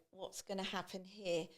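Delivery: ordinary talk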